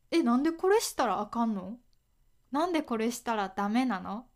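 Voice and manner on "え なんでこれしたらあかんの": quick question with Kansai intonation; 関西弁. Shown as a demonstration. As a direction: ask briskly in a Kansai accent. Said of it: "なんでのイントネーションも". (関西弁の抑揚で早口に尋ねる)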